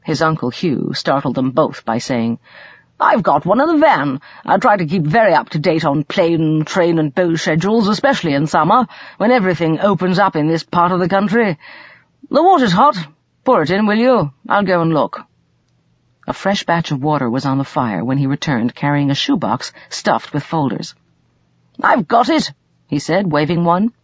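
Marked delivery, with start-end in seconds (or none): 3.0-15.2 raised pitch, lively and chatty uncle; animated uncle. Acted. 21.8-22.5 higher-pitched, pleased announcement; animated uncle. Acted.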